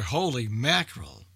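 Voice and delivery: normal talking voice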